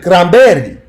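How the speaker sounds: cranberry said as "crahm-BEH-ree" with a tapped r; mock Spanish accent. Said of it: "say it with a Spanish accent".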